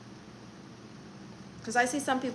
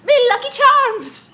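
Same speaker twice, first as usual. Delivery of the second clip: high, loud hammed-up Irish accent; cartoon Irish accent, mock-horrible; bad Irish accent; 13 semitones higher, 15 dB louder than usual. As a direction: squeal it like a terrible Irish leprechaun. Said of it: "I should do an Irish accent, but it's gonna be horrible".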